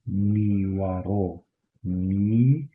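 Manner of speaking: "niwaro" slowly spelled out, ni-wa-ro; Kurdish niwaro. Shown as a demonstration. Teacher repeats Kurdish afternoon slowly for students. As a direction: drag "niwaro" out for learners to copy. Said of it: "how we say noon or afternoon".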